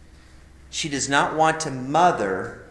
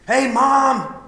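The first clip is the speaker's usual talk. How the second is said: higher, louder excited shout to mom; calling out to mom; 11 semitones higher, 7 dB louder than usual. Retold or imitated. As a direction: yell hello to mom into the camera. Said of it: "you hear a guy goes".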